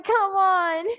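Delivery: high, teasing student whine; playful mock groan of protest; mock bored complaint. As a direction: imitate a fed-up student, half laughing. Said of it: "Grady is already bored".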